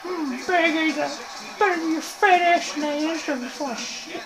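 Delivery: high-pitched put-on creature voice; Yoda. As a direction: play a little cartoon sage with hummed mm's. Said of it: "People say I sound like Yoda".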